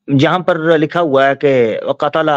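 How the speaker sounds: usual speaking voice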